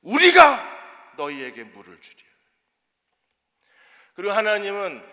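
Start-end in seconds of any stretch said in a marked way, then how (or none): 0.0-2.3 loud, hard proclamation; shouting. Acted. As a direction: roar it at the people in anger. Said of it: "이렇게 소리 지릅니다". (완악하게 크게 외침)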